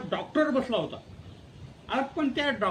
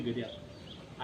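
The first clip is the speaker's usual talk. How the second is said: very soft, very slow and lower; weak patient; 9 semitones lower, 10 dB quieter, much slower than usual. Acted. Hurt patient telling the dentist he's going home, faint and slow. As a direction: sound drained after losing the tooth.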